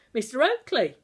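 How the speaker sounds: loud, high call from a window; a glad shout of greeting. Acted. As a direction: hail him from above. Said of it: "cried the brawny, dark-haired man".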